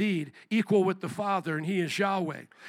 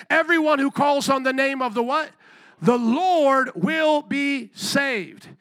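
loud, raised-pitch sermon delivery; emphatic call-and-response preaching; full-force preaching; 4 semitones higher, 4 dB louder, slower than usual. Real, not acted. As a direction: drive the verse home to the congregation.

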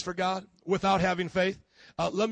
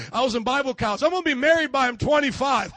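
mock confident boast; 5 semitones higher, 6 dB louder than usual. Mocking a confident planner.

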